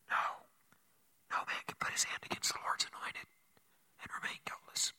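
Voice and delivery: very soft, voiceless refusal; whisper. Acted. David to Abishai over the sleeping king, whispering. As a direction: forbid it in a bare whisper.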